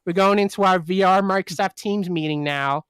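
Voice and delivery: hammed-up American office boss rallying the team; annoying American middle-manager accent. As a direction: put on a grating American corporate accent. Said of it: "that's my annoying American middle manager accent".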